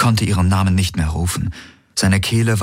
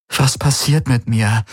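higher, lost question; distraught, pitched up; bewildered despair; 6 semitones higher than usual. A narrator's reading. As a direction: ask it helplessly of yourself. Bewildered, covering his eyes.

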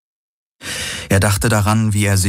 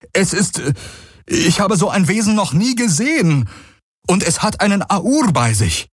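higher, breathless alarmed warning; alarmed report; 13 semitones higher than usual. Acted. Messenger warns Kessli of a stranger, high and alarmed. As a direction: bring bad news in a rush.

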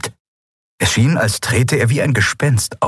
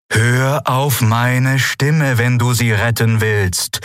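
slow, drawn-out, raised voice; commanding guide; 5 semitones higher, slower than usual. Acted. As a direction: insist slowly, stretching the words. Guide insists while she despairs.

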